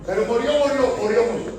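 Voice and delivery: each murió punched loud and falling; repeated emphasis. Acted. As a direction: hammer every murió with a falling stress. Preacher hammers the word.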